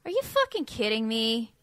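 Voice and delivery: flat, irritated "Are you fucking kidding me?"; disgusted "Are you fucking kidding me?"; annoyed take. Shown as a demonstration. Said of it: "Do you think he's saying".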